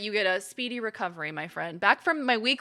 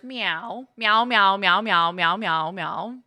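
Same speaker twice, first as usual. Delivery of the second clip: playful meowing; 3 semitones lower, 6 dB louder, much slower than usual. Pretend.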